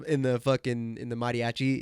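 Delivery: usual speaking voice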